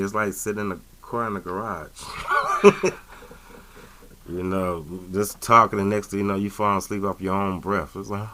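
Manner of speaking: ordinary delivery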